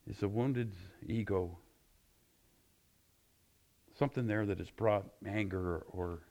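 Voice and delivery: soft, reverent prayer voice; closing prayer. Acted. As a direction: pray it quietly to the Lord.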